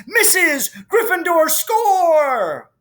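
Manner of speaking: high, loud triumphant cry, stretching out the score; goal shout. Acted. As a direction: yell the goal like the crowd just erupted. Commentator celebrating a goal.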